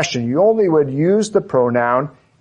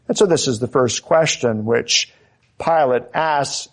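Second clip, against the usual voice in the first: ordinary talk, nothing marked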